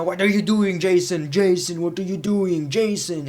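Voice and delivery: imitating an angry general manager; mock angry boss. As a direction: scold him like an angry boss. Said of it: "Kurt Angle is angry".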